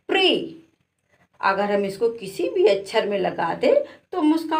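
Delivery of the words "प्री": प्री said high with a falling end; model pri. Shown as a demonstration. Teacher gives प्री as the class answer. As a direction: drop प्री down clearly. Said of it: "इसको पढ़ेंगे".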